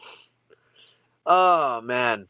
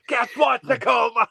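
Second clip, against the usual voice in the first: mock stage yell; 10 semitones higher, 3 dB louder than usual. Retelling [name]'s Tacoma show, a mock stage yell. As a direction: ham up a rock drummer hyping the crowd. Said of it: "he keeps saying".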